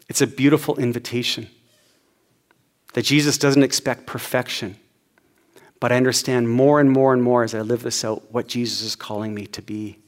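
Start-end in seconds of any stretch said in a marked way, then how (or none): none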